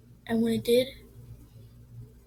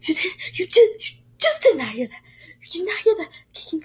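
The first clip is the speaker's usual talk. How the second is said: frantic; 7 semitones higher, 8 dB louder than usual. Retold or imitated. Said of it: "It's like".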